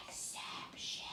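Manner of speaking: whispered "exception". Shown as a demonstration. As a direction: breathe it out like a secret. Teacher whispers rule word.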